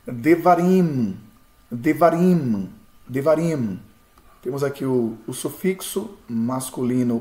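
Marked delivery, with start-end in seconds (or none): 0.1-3.8 devarim, syllable by syllable, slowly; slow spelled-out devarim. Shown as a demonstration. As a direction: sound out devarim slowly for students. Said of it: "nós temos a expressão aqui em branco". (pronúncia lenta de devarim)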